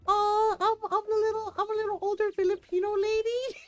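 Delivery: high, gushing mock gasp of delight; touched squeal, played up; flattered. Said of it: "I was very flattered".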